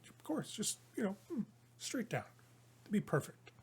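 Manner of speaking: hushed, acted persuasion; Vanathra coaxing. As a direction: urge very quietly, as if soothing. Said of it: "you see Vanathra on the other side".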